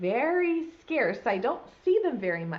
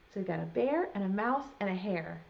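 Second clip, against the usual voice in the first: ordinary delivery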